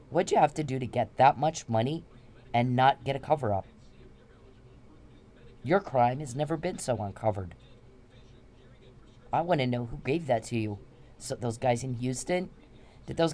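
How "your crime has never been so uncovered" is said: ordinary talk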